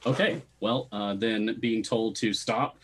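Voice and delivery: ordinary talk, nothing marked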